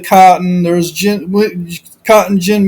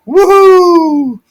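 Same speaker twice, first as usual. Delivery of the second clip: high, loud celebratory whoop; party whoop; 9 semitones higher, 4 dB louder than usual. Acted. Preacher whooping as the father's feast begins, excited. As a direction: cheer like the party just started.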